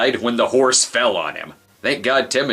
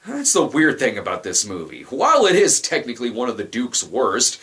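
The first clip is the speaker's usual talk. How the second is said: ordinary talk, nothing marked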